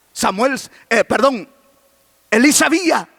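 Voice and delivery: normal talking voice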